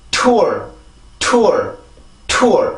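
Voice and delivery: tour with blocked air released in a strong T; strong English T. Shown as a demonstration. Teacher showing how blocked air strengthens it, strong English T. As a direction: hold the T, then burst into falling tour. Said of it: "다시 한번".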